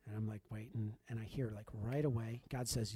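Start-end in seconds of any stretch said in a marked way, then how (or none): none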